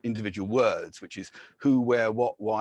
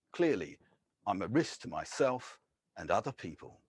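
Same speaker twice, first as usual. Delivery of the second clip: poem reading; 5 semitones higher than usual. Acted. Poet reads a mock train announcement poem, wry and earnest. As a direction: read it as a dry, ironic public confession.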